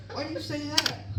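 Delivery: high, soft, puzzled question; voiced boss. Boss puzzled by the little girl's joke. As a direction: ask it with mock puzzlement.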